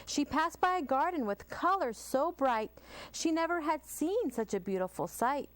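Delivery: ordinary delivery, nothing marked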